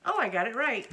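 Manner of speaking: delighted little shriek of a laugh; pleased surprise, laughing; surprised laugh. Real, not acted. Surprised she read the number right.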